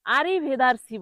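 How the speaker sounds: আরিভেদারসি with "dar" for "der"; learner's slip আরিভেদারসি, open "a" before rs; common mispronunciation. Shown as a demonstration. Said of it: "বলে".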